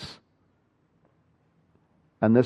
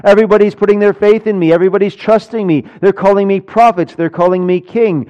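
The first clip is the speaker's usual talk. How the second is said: higher, rapid building appeal; eager declaration; 6 semitones higher, 4 dB louder, faster than usual. Acted. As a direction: rattle off the triumphs, pitched up and insistent. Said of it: "Why doesn't he just say".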